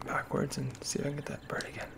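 whisper. Real, not acted. Gamer whispering repeat to self.

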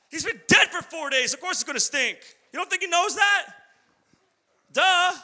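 high, sassy duh; mocking retort. Preacher talking back to Mary, high and sassy. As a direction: roll your eyes at her.

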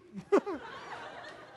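airy giggle up high; breathy high laugh. Real, not acted. Pastor Scott fell asleep.

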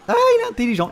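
high sarcastic exclamation; mock the opponent with a high cry; mock surprised praise. Real, not acted. Player mocking the opponent's clever move, high sarcastic.

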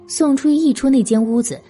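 normal talking voice

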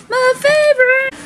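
high, lilting second "favorite"; sing-song high repeat. Real, not acted. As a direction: lift "favorite" way up, playful and chirpy.

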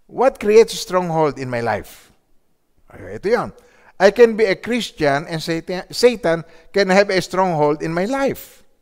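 loud, slow emphatic questions; fiery preaching. Real, not acted. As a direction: press the congregation. Preaching at full force.

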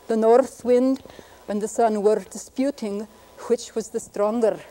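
slow Scottish reading with unaspirated p, t, k and rolled r; Scottish accent. Shown as a demonstration. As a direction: read it slowly in a Scottish accent, rolling the r. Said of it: "Here's a Scottish version".

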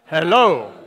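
low hello with a laugh; deep hello for Joseph. Shown as a demonstration. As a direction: drop into a low man's greeting.

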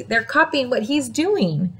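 ordinary delivery

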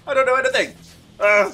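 high, loud panicked outburst; flustered. Real, not acted. Gamer out of practice, lost mid-match, flustered outburst.

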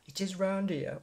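broad Nottingham accent, played up. Shown as a demonstration. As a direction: lean into the Nottingham vowels on "round here". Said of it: "my Nottingham accent coming through there".

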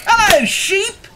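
high, slow and bright hello from Duck; cheerful duck greeting. A narrator's reading. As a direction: sing out a friendly hello as Duck.

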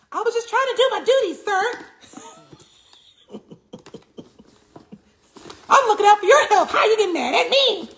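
high, fast, whiny self-defence, through laughter; whiny hall monitor. Pretend. The scolded viewer defending herself, high and whiny. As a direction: play the scolded teacher's pet pleading innocence.

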